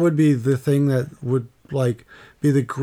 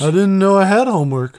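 whiny student excuse; 4 semitones higher, 3 dB louder than usual. Retold or imitated. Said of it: "when I forgot to do my homework".

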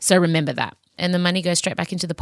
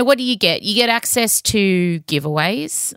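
normal talking voice

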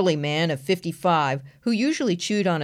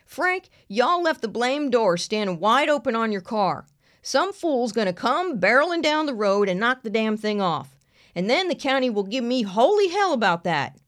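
raised, hollering Southern sheriff; 6 semitones higher than usual. Acted. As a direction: call it out like a gruff country lawman. Sheriff yells at deputy.

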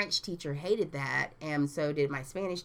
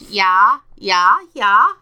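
loud, repeated Swedish "ja"; very loud put-on Swedish affirmation; mock Swedish accent; 4 semitones higher, 17 dB louder, much slower than usual. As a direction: parody a Swedish yes at full volume. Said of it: "That's like Swedish".

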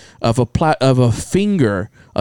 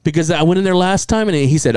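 ordinary talk

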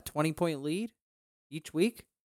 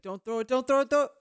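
anxious pleading; 11 semitones higher, 5 dB louder than usual. Retold or imitated. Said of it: "type of throws where you're like".

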